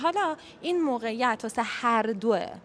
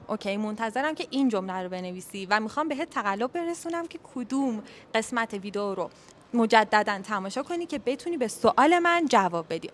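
ordinary talk

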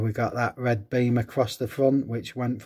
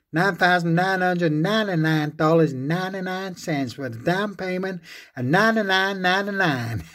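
mock American accent, pitched higher; 6 semitones higher than usual. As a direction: sell it like a brash American car dealer. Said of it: "play on the American accent".

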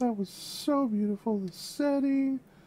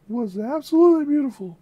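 excited praise; 8 dB louder than usual. Real, not acted. Praising the song.

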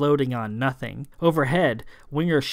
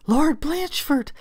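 high, slow desperate calling; crying out; 3 dB louder than usual. A narrator's reading. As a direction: call out to their lord. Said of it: "the staff cried".

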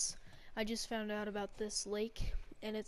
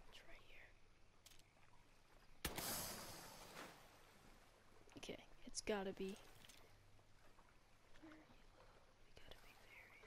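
soft unvoiced whisper; 10 dB quieter than usual. Real, not acted. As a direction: hush while hunting. Hushed stalking.

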